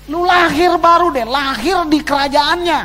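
breezy, as if God says it offhand; casual voice of God. Retold or imitated. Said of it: "Tuhan cuman ngomong begini".

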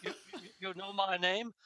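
mock-grand greeting, breaking into laughter; a light, laughing take on the wizard; Tim the Enchanter. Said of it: "Monty Python and the Holy Grail".

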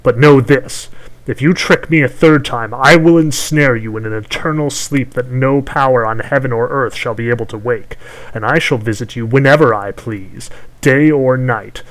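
loud, menacing crone voice; threatening hag. Acted. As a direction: threaten him like a vengeful witch.

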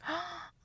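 sharp gasp. Real, not acted. Reacting to news about AI.

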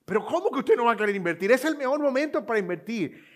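high, loud, fast, exalted sales pitch; hammed-up thrilled saleswoman insisting; overexcited saleswoman. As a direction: imitate a telemarketer who can't believe you'd say no. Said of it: "ella estaba como exaltada, emocionadísima".

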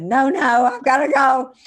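pushing people away while choked up; retold upset outburst. Retelling leaving office in tears.

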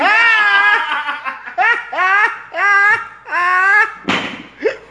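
very high shrieking laugh, near tears; hysterical laughter. Real, not acted. Storyteller cracks up at his own punchline, shrieking laughter. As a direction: wheeze out the laughter after the punchline.

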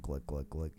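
low, soft glug-glug drinking sound; gulping noise. Acted. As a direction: imitate liquor going down, quietly. Imitates drinking while reading aloud.

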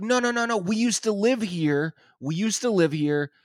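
high mock protest, easing down at the end; retold pleading. Former tenant pleads with angry neighbour, retold pleading. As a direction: act out insistent begging.

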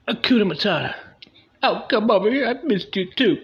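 high, loud, breezy greeting; goofy carefree singsong. Retold or imitated. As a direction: play it off like nothing's wrong, way up high. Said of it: "and you're like".

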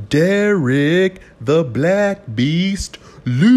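high, loud, very slow ring-announcer call; naming the UFC fighter like a ring announcer. Acted. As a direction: stretch the name out like a ring announcer.

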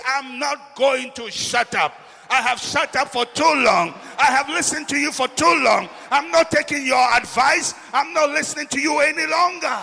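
beggar defying the crowd that hushes him; defiant outburst. Acted. Bartimaeus defiant refusal to stop.

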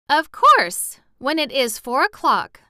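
bright-pitched, loud 'of course', easing off; high and emphatic, amused by the question; amused reply. A narrator's reading. Girl amused at boy's startled expression, amused reply. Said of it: "with a laugh at his startled expression".

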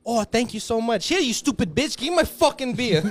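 put-on snide drunk customer; mock-polite then rude. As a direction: sneer at the cashier. Customer mocking cashier.

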